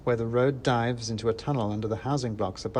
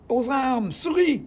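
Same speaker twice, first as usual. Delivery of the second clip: very high, loud squeaky call to arms; rallying mouse cry; 14 semitones higher, 6 dB louder, slower than usual. Acted. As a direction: summon the mice to battle. Mouse rallies comrades.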